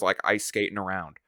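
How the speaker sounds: ordinary talk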